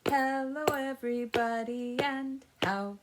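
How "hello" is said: usual speaking voice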